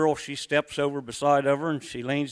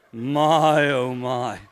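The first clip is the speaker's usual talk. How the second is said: mock swoon; 6 dB louder, much slower than usual.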